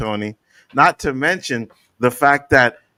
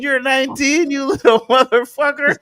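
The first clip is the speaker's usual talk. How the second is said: high, loud, amazed outburst; stunned that the trash-talker is nineteen; incredulous disbelief; 12 semitones higher, 4 dB louder than usual. Retold or imitated. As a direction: shoot up in disbelief. Reacting that opponent is nineteen.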